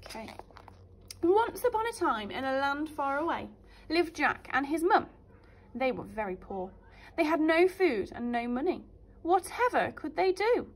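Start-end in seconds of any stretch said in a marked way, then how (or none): 1.2-10.7 put-on lively storytime voice for children; story narration. A narrator's reading. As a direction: read it like a teacher to little ones. Teacher reads fairy tale aloud.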